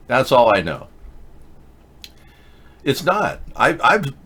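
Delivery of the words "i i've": ordinary talk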